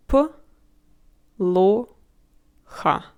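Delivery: плохо as П, ло, ха with long pauses; broken into parts. Shown as a demonstration. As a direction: give the first letter alone, then each syllable. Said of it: "say this single letter at the beginning".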